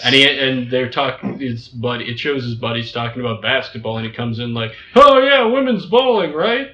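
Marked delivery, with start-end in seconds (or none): 5.0-6.7 higher, louder eager know-it-all; hammed-up clueless sports fan; clueless guy voice. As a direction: blurt it out proudly, totally wrong. Gordo's clueless sports remark.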